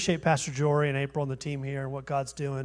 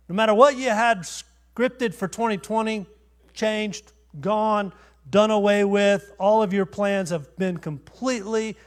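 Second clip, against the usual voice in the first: rising preacher intensity; 4 semitones higher, 5 dB louder than usual. Real, not acted. Preacher building to a climax.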